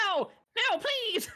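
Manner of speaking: very high, loud panicked squawk; screaming chicken. Acted. Chicken being carried back to the cage, screaming. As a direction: scream for mercy as a little chicken. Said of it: "it screaming".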